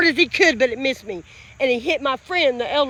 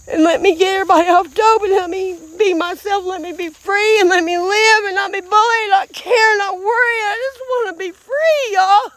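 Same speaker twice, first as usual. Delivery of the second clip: pleading, higher, close to crying; tearful plea; 4 semitones higher than usual. Real, not acted. Vlogger begging to live free of bullying, tearful plea. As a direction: plead to be free, voice climbing with tears.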